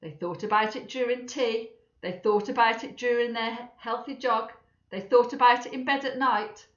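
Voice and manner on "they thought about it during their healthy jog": ordinary delivery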